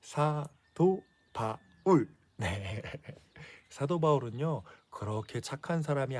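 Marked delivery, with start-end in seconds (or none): 0.0-2.1 very slow, spelled-out name again for echo; slowly drawn-out teacher prompt; slow call to repeat. Shown as a demonstration. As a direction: stretch the name out syllable by syllable. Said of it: "누구라고요?".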